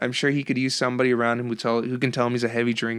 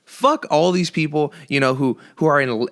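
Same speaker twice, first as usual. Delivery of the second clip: ordinary delivery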